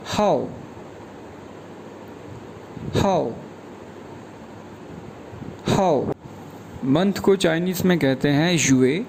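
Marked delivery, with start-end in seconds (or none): 0.1-6.1 हाओ with a clear falling tone; falling fourth tone. Shown as a demonstration. Teacher drilling हाओ, falling tone. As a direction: drop hard on हाओ. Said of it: "ये pronounce करेंगे".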